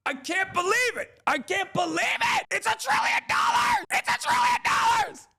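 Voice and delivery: very high, strained yells of disbelief; exasperated shouting. Real, not acted. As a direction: rant as if losing your mind over the cost. Ranting about trillion-dollar jet.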